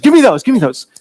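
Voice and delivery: snatch at them greedily; grabby Leah. Retold or imitated. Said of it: "He's like".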